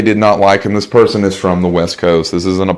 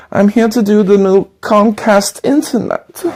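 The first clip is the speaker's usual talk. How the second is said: put-on salesman with a thick German accent, slow and polite; a German door-to-door salesman greeting a customer; 11 semitones higher than usual. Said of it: "German door-to-door salesman".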